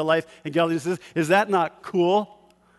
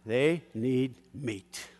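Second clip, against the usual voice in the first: deadpan; 5 semitones lower, 5 dB quieter, much slower than usual. Real, not acted. Preacher's deadpan punchline.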